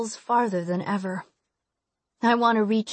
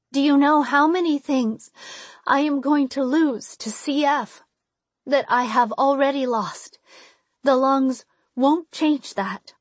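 desperate outburst; 5 semitones higher than usual. Acted. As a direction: pour out the despair.

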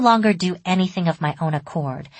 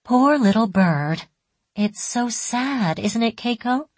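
slow, higher-pitched coaxing sorrow; murmured pity; 6 semitones higher, slower than usual. A narrator's reading. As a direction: draw it out mournfully to convince a child. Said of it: "my mother kept murmuring, as if trying to convince me".

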